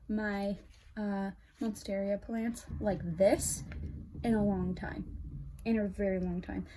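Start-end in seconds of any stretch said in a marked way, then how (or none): none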